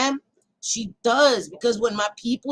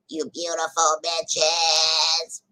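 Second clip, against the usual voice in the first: very slow, lower drawn-out voice; slow put-on voice; 6 semitones lower, much slower than usual. Real, not acted. Streamer reads a donation in an odd voice, slow. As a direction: stretch it out like a voice effect. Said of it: "Hate that shit".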